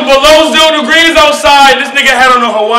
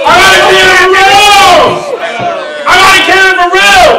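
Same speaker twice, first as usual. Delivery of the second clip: higher, louder, punch the bar; shouted repeat; 6 semitones higher, 4 dB louder than usual. Acted. Rapper to opponent, repeating his punchline, loud and higher. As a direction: hammer the repeat home at the crowd.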